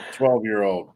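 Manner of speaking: ordinary delivery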